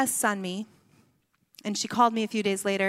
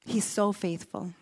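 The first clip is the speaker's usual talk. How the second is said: tender. Acted. Repeated for emphasis, soft.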